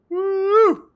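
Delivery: sudden high, loud woo; excited whoop. Real, not acted. Streamer whoops at her dog, high and loud. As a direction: cheer him on with a burst.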